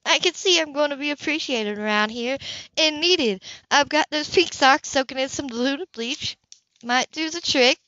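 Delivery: an easygoing neighbour offering help; Grace, friendly. Acted. Narrator voices Grace.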